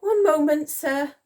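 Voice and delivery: high, loud soldier's reply; flustered soldier. A narrator's reading. Soldier stalling the Admiral, flustered and high. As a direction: call back to your officer. Acted soldier answering under orders.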